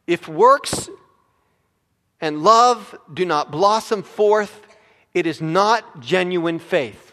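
loud, weighty sermon declamation; full-voiced, emphatic pulpit delivery; forceful preaching. Real, not acted. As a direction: proclaim it slowly and firmly from the pulpit.

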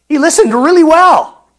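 "really well" punched loud and high; emphatic punchline. Real, not acted. Preacher drives home the climber's listening, loudly emphatic. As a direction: belt out "really well" with a big falling finish.

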